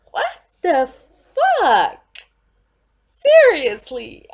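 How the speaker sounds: loud, slow, disbelieving curse; outraged. Real, not acted. Fan outraged at the movie, loud and slow. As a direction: drag it out in outrage.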